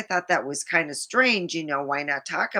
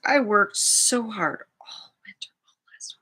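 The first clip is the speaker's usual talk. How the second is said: whisper; 4 semitones higher than usual. Real, not acted. Whispered lament about winter.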